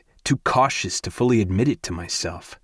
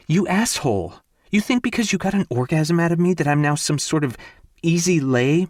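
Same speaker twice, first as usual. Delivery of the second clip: higher, fast furious accusation; angry outburst; 7 semitones higher than usual. Acted. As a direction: hurl it at him, outraged. Ivy furious at Gray's remark.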